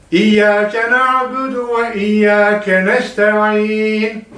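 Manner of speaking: na'budu with the ayn polished and e sounds thin, slow. Shown as a demonstration. Teacher models the ayn and thin e for students. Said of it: "Sonundaki aynı cilalatarak çıkartın".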